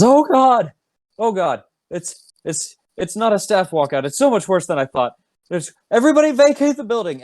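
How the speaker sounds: high frantic panicked yell; panicked Otis. Acted. Cook Otis screaming at the crowd, panicked and high. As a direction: shriek the warning as a terrified cook. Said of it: "and he says".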